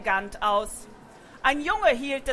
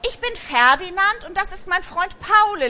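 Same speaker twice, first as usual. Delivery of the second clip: lightly voiced young donkey, higher; a polite little donkey, a touch higher; 7 semitones higher, 4 dB louder than usual. Ferdinand stellt sich der Katze vor. (leicht gespielter junger Esel, etwas höher)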